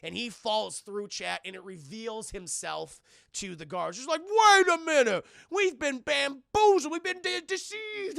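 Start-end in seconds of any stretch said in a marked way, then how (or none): 4.2-8.2 high, loud, slow cry of being fooled; hammed-up outrage at being tricked; mock alarmed guard.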